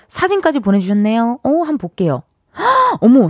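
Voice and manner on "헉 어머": sudden high gasp of delight; surprised gasp. Real, not acted. Sees the fish photo.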